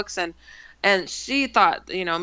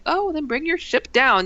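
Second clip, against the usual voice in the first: higher-pitched sarcastic challenge; smart aleck; 7 semitones higher than usual. Retold or imitated. Narrator dares the alien to show its ship, smart-aleck. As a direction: call the bluff, pitched up and cheeky. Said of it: "I'm being a smart aleck".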